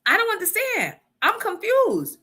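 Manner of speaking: high, lightly puzzled therapist; imitating therapist. Therapist questioning the husband, voiced high and puzzled. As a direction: play the confused therapist. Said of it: "So the therapist goes".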